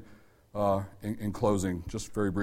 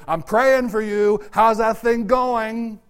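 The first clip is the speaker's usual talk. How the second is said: slow, high caring question; mildly tender, slow; caring check-in; 9 semitones higher, 6 dB louder, slower than usual. Retold or imitated. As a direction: gently ask after a friend. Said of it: "tap me on the shoulder and say".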